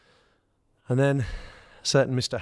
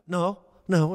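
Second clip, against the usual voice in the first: high, hurried no no, caught out; panicked denial; 9 semitones higher than usual. Retold or imitated. Player caught out by his manager, panicked high denial. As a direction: blurt it out in a mock panic. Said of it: "and panicked".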